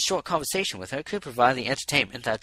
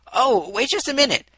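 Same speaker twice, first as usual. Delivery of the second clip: hasty; 12 semitones higher, 8 dB louder than usual. Acted. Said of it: "she said hastily".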